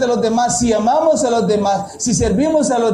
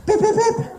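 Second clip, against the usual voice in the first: much higher mock horn beeps through a laugh; 11 semitones higher than usual. Joking about the urge to honk, high laughing beeps. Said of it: "Dan ganas de decir".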